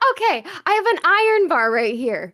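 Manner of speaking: ordinary talk, nothing marked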